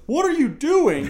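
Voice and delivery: high, loud, slow what are you doing; hammed-up startled guard, drawn out; mock guard yell. Mocking the movie's guard, a high loud mock yell. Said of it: "the other guard comes in and goes".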